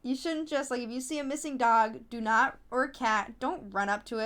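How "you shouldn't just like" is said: ordinary talk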